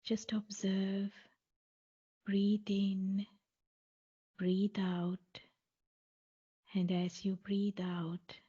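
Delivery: guided meditation. Acted. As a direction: guide listeners calmly, phrase by phrase, leaving space between. Leads a guided mindfulness body scan.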